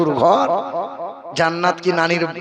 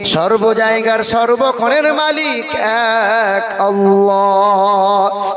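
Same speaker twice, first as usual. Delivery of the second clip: chanted, sing-song sermon, louder and slower; full-force preaching; 3 semitones higher, 3 dB louder, slower than usual. Acted. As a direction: preach it in a rising chant to the crowd.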